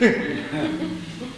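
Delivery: short high burst of laughter; a bright little laugh; high giggle. Real, not acted. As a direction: giggle at the memory. Laughing at his own story.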